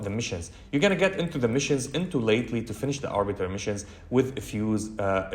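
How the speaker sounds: ordinary delivery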